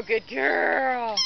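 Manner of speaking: ordinary talk, nothing marked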